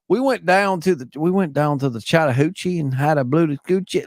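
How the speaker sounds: Southern accent, drawn out and clear; slow the Southern accent down so every word lands. Shown as a demonstration. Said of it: "like, slow that down".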